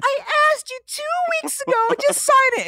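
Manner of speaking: put-on squeaky teen girl voice; high whiny teen pleading; high-pitched daughter voice. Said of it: "she's gonna scam you".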